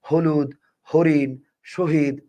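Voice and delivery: slow, separated হলুদ, হরিণ, শহীদ with a heavily aspirated h; breathy voiced h. Shown as a demonstration. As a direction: push extra air out on the h of হলুদ, হরিণ, শহীদ. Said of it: "মুখ থেকে দেখবেন বাতাস বেশি বেরোবে".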